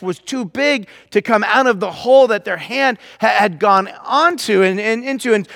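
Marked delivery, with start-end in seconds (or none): none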